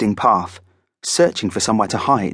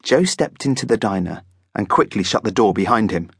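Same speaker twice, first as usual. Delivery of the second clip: ordinary delivery, nothing marked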